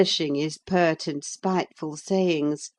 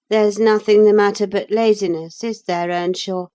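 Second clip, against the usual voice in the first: taunting; 4 semitones higher, 6 dB louder than usual. Acted. Linton taunting Hareton.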